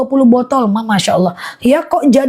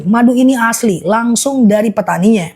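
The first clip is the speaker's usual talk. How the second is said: ordinary talk, nothing marked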